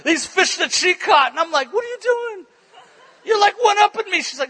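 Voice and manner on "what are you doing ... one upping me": high-pitched, affronted protest; hammed-up outrage, pitched right up; mock indignation. Husband protests wife's bigger fish picture, mock indignation.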